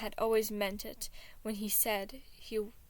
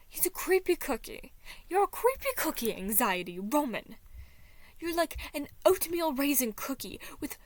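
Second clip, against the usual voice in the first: higher and louder, rising indignation; getting louder; 5 semitones higher, 4 dB louder than usual. Acted. Roman ranting about Anxiety, growing louder. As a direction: build up the rant. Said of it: "The prince gradually got louder".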